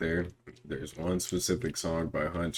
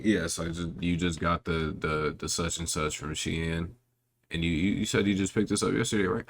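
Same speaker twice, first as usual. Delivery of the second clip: rambling caller voice. Retold or imitated. Imitating a caller on FaceTime.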